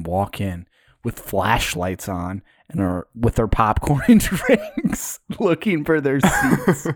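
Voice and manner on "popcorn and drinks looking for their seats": helpless laughter stretching the words; laughing. Real, not acted. Telling friends about old men arriving late, laughing. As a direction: struggle to get the words out through laughs. Cracking up while telling the story.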